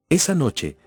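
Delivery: usual speaking voice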